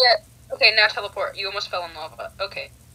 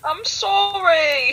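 high, stretched-out plea; drawn-out apology; 7 semitones higher, 4 dB louder, much slower than usual. Real, not acted. Game player to a scolding friend, drawn-out high apology. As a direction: plead it louder, dragging the vowels.

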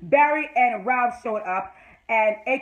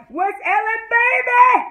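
excited fan cheer; 12 semitones higher, 8 dB louder, slower than usual. Real, not acted. Fan cheering her favorite couple, excited.

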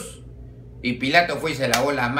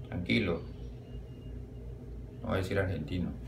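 much lower and softer, almost under the breath; hushed reassurance; 8 semitones lower, 12 dB quieter than usual. Acted. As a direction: mutter it so only the bishop hears. Priest secretly reassures the bishop.

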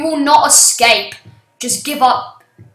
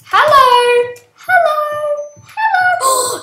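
each hello higher and more gleeful; rising taunting hellos; 11 semitones higher, 6 dB louder, slower than usual. Acted. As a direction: call out teasing, climbing up. Hunter calls, pitch climbing each time.

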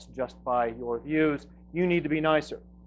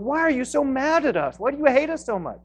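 much higher, accusing reporter voice; mocking reporter; 10 semitones higher, 4 dB louder than usual. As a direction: play the interviewer as shrilly accusing, pitched high. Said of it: "And he keeps saying".

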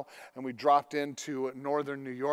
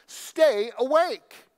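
raised, very slow and emphatic; urgent exhortation; 8 semitones higher, 6 dB louder, much slower than usual. Acted. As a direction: press each word on the listeners. Preacher repeating key exhortation.

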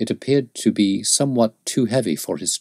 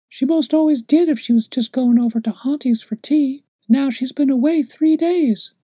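put-on high child's voice chattering; little girl; 5 dB louder than usual. A narrator's reading. Little girl tells the man about Mother and thirst, high child voice. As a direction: innocent prattling child, much higher.

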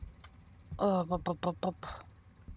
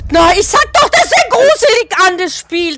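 loud, high, rattled; shaken after the scare; 9 semitones higher, 13 dB louder than usual. Real, not acted. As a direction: still shouting from the fright. Nach dem Jumpscare. (laut und hoch vor Schreck)

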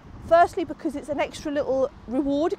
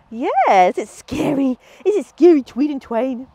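loud, bright reassurance to the dog; playful dog talk; 7 dB louder, slower than usual. Real, not acted. Trainer reassuring Magpie about the treat machine, loud and playful. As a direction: make the machine sound fun.

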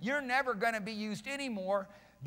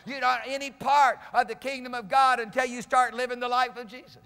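driving pulpit delivery, building louder; full-force preaching; 3 semitones higher, 7 dB louder than usual. Real, not acted. Preacher calling out posers and pretenders, full-force preaching. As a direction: press on relentlessly into a loud preaching climax.

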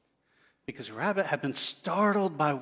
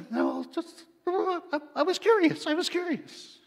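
much higher, small pleading owl voice; guilty owl; 14 semitones higher, 4 dB louder than usual. Acted. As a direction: stammer out the excuse like a caught child. Owl excuses itself to Creator.

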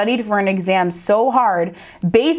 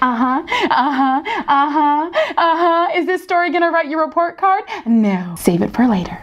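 sing-song mock teacher rising higher; nosy teacher; 6 semitones higher than usual. Teacher squeezing between chatting students, mock sing-song. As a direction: needle the chatting kids. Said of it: "be like".

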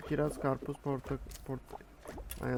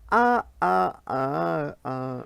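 homemade sound effect; 2 semitones higher, 6 dB louder, much slower than usual. Shown as a demonstration. Gamer voicing his own falling sound effect, loud then fading. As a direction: do your own falling-character noise with your voice. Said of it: "Bak kendi ses efektimi yaptım".

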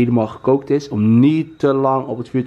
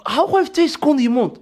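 indignant shout; 9 semitones higher than usual. Real, not acted. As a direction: shut him up sharply. Cuts in on a friend mid-compliment.

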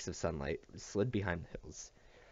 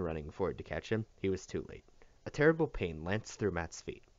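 plain reading voice

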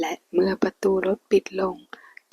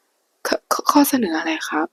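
stammering, voice jumping higher; nervous stammer; 8 semitones higher, 3 dB louder, much slower than usual. Acted. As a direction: stutter it out in sudden panic. Fang panics at the news.

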